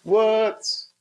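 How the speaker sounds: drawn-out playful British accent imitation; mock British accent. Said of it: "I wanna learn how to talk like you".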